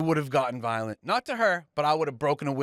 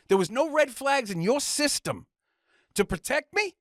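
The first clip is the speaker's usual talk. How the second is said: outraged complaint; 9 semitones higher than usual. Retold or imitated. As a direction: pitch it up high, indignant, a bit slower. Angry rant at caseworker.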